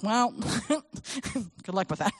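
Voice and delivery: slow, laughing brush-off; mock dismissive. Adults brushing off a doubting child, slow laughing dismissal. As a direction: shrug it off with a laugh.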